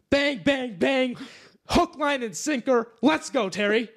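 high, punchy celebration; cheering. Real, not acted. Hyping Terry Pratchett's river joke, high excited cheer. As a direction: celebrate like a goal was scored.

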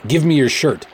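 high, pressing order; commanding. A narrator's reading. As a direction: order it firmly and urgently. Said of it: "I commanded".

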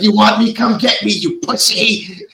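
high-pitched hammed-up challenge; high retold taunt, half laughing afterwards; mock defiant taunt. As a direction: imitate a hysterical dare, stretching the final insult. Acting out frantic challenge to Death.

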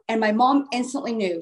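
usual speaking voice

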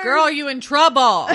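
loud laughing warning; laughing shout. Acted. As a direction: holler at the screen while laughing. Yelling at horror film heroine.

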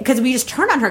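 ordinary talk, nothing marked

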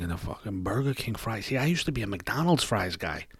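ordinary delivery

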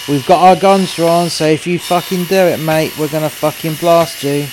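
police threatening the man behind the door; police warning shout. Retold or imitated. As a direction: shout it with guns drawn. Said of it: "you got the police going".

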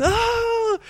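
high swooping "Oh!"; mock crowd going wow; high delighted crowd cry; crowd's gasp. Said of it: "the whole crowd just goes".

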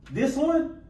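hyped-up squeal on this one; excited. Real, not acted. Host hyping up the guest's big moment, excitedly. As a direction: climb high and loud with excitement.

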